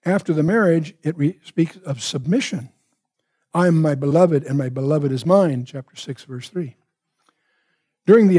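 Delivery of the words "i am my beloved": ordinary talk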